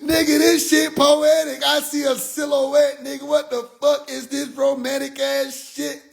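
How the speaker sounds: high, slow mock outrage; high-pitched comic indignation; worked-up mad. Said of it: "I got mad".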